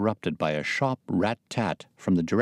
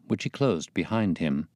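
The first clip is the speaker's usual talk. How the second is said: ordinary delivery, nothing marked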